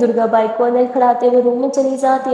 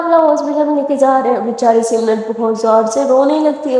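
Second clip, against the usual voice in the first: plain reading voice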